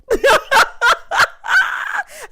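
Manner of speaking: high screaming laugh; loud shrieking laugh. Real, not acted. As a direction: howl with laughter. Hollering at the reality scene.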